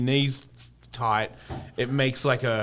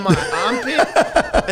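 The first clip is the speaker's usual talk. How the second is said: slow, laughing through it; cracking up mid-word; 9 semitones higher, 4 dB louder, much slower than usual. Real, not acted. Laughing about the tattoo.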